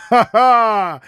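high, exuberant ha-ha; boisterous laugh. A narrator's reading. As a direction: laugh it out in character. Mitya laughs in card game. (задорный смех)

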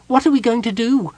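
high, hesitant kender question; a wary kender holding back; high kender voice, unsure and wary. A narrator's reading. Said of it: "asked hesitatingly".

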